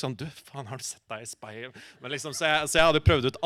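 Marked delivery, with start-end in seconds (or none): 0.2-1.8 much softer, kindly concerned tone; sympathetic. Retold or imitated. Rehearsing the bully line, soft sympathetic take. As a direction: say it gently, like you care. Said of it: "jeg prøvde liksom å være liksom sympatisk".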